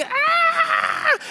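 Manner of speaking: very high, loud piercing scream; a creature's shriek of agony. Acted. Lizard shrieks as the angel seizes it, a very high loud scream. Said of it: "there's a loud shriek".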